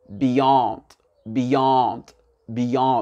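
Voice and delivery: "beyond" with brief "bi" and long, falling "YOND"; stress on second syllable. Shown as a demonstration. Teacher modelling the word for learners, second-syllable stress. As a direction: hit "yond" in "beyond", open vowel. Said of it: "L'accent tonique".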